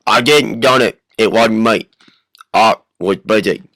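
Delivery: loud, loutish denial; Mr. Hyde. Acted. Hyde denies the crime on air, loud brute voice. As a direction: deny it bluntly in a brute's voice. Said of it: "this is Mister Hyde".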